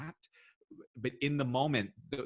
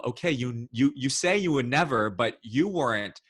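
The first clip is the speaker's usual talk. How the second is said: usual speaking voice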